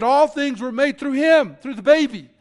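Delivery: fervent full-voice preaching climax; preaching peak. Real, not acted. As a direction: proclaim it to the back row.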